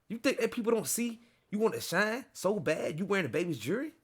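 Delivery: high, mocking rapper imitation; imitated accusing rant, high-pitched. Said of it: "I gotta read it in the fifty voice".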